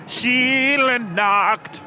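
slow, stretched mock German officer; movie villain German accent. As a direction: drag it out like a film Nazi officer. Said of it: "Why do you turn into, like, someone, like, Nazi officer".

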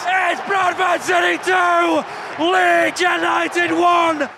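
slow, high, loud stretched scoreline; long goal yell. Real, not acted. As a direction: draw out every word of the score. Drawn-out score call after goal.

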